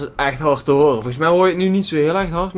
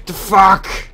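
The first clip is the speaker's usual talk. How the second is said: frustrated shout; 5 semitones higher, 7 dB louder than usual. Real, not acted. Frustrated gamer cursing at game.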